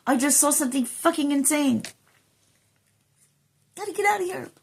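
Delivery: mock-panicked escaping person. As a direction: flee in a freaked-out voice. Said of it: "this person's like".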